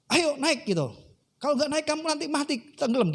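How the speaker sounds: high-pitched acted order from a rescuer; forceful urging. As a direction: press him to comply, without shouting. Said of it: "Dengan sedikit paksa". (mendesak dengan sedikit paksa)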